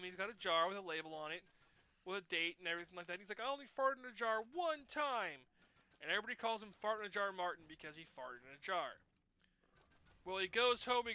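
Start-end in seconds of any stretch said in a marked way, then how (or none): 3.4-5.4 put-on whiny defensive kid. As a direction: drag it out like an embarrassed kid pleading. Martin defending himself.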